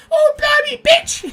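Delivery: loud, very high parrot squawk; mimicking parrot. Zoo parrot swearing to get a laugh, loud high squawk. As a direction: screech it like a swearing English parrot. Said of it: "all the parrots would start going".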